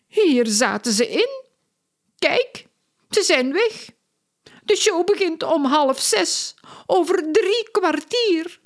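high-pitched, eager circus woman; excitable circus lady. A narrator's reading. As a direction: play Lola, breathless with worry. Lola frets about the show.

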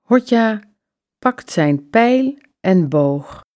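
usual speaking voice

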